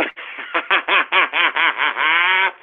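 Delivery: long, rolling laugh; mocking laugh. Real, not acted. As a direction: laugh in a drawn-out string of ha-has. Mocking Cantwell after the film comparison.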